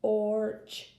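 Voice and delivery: "orch" stretched as its own chunk; sounding out. Shown as a demonstration. Reading teacher blending sounds, "orch" held apart. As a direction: say "orch" alone, drawing out the or. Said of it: "So we have p".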